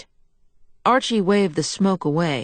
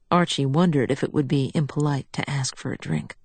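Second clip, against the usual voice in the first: plain reading voice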